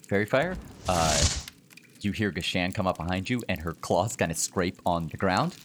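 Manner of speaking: ordinary talk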